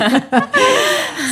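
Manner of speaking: a burst of high real giggling; high giggle. Real, not acted. Laughing about her husband.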